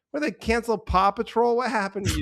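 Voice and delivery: high, hammed-up sarcastic question; mock disbelief. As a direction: tease the teen dancers for their drama. Mocking teens' angst.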